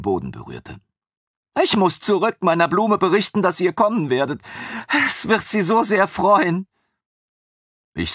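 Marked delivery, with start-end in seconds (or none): 1.6-6.7 high, gushing voice of the eager servant; devoted young servant. A narrator's reading. Armin gushes to his former master about his happy lioness, high and eager. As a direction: pour out relief and adoration, much higher. Armin hurries off to tell her.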